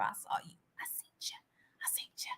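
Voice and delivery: playful whisper to a friend; breathy, playful catching-you whisper; teasing whisper. Acted. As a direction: whisper it like you caught them peeking. Teasing a viewer who follows her.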